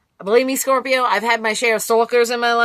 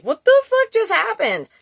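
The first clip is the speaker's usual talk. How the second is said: pitched-up mock bewilderment; baffled outburst; 10 semitones higher than usual. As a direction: blurt it out in shock. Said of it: "you're just like".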